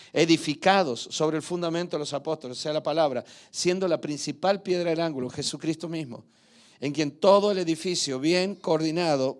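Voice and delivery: loud, fervent scripture reading; preaching scripture. Acted. Pastor proclaiming Bible verses to his church, loud preaching.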